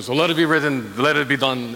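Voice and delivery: a light hint of Yul Brynner's accent, regal and low. Preacher mimicking Yul Brynner's Pharaoh with a light accent. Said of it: "in his little accent".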